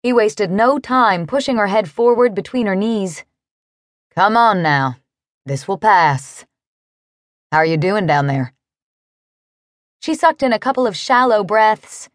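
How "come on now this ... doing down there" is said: soothing cowboy. A narrator's reading. Narrator voices the cowboy.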